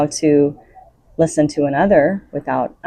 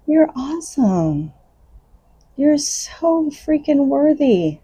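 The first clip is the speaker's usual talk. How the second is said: talking to a newborn as if it were perfect, much higher and tender; tender praise to a baby; 8 semitones higher than usual. Pretend. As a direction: coo over a newborn, each line cherished. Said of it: "the way that you look at a newborn baby and go".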